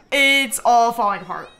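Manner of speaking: loud, dismayed outcry; dramatic lament. Real, not acted. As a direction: wail over the collapse. Lamenting the collapsing household.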